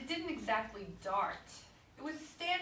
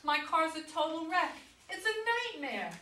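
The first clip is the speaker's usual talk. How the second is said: upset wail; 6 semitones higher than usual. Acted. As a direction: wail over the wrecked car. Acted quarrel, wife upset.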